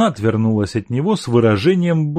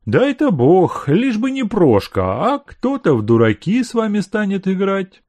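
jealous servant; 9 semitones higher than usual. Acted. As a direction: grumble it as a jealous manservant.

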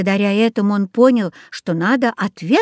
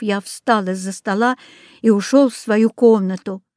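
ordinary delivery

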